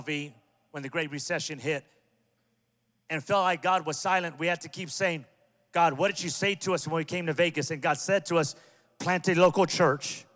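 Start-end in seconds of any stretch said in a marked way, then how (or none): 3.1-10.3 preaching. Real, not acted. Preaching at full force.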